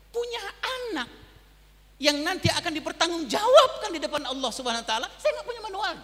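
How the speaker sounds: pleading, with a wet laugh breaking through; near tears. Real, not acted. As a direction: voice jumps high, close to crying. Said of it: "Mau nangis".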